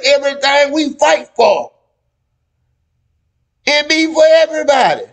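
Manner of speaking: high-pitched, emphatic outcry; impassioned shout. Real, not acted. Host making the case that the fight helps everybody, impassioned shout.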